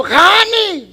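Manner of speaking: high, loud call across a crowded room. Retold or imitated. Husband calling his distant wife, loud high call. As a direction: shout her name to get her attention.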